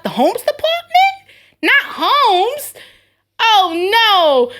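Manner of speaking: high, loud mock-panicked squeal. Coworker mocking panic over the homes department, high and loud. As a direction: ham up the panic over a messy aisle. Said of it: "And I'm like".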